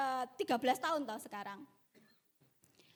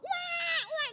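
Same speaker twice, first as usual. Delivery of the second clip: high, loud "waah" wails; imitated baby crying; 4 dB louder than usual. Mother imitating her newborn, high mock crying. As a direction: bawl like a newborn, mockingly. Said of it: "gitu, nangis".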